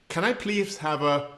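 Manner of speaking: asking for a Kopparberg the English way; retold English-style order. Tourist in a Swedish pub ordering a cider, English-style pronunciation. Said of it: "they did not know what he meant".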